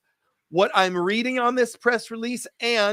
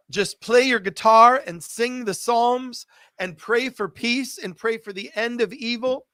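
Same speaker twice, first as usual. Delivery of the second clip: ordinary delivery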